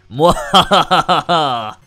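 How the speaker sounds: slow booming evil laugh; villain laugh. Acted. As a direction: cackle like a villain. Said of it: "That's the villain voice".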